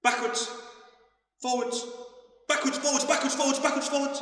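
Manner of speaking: high, loud rapid-fire calls; quick shouted commands. Real, not acted. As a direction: rattle off the directions.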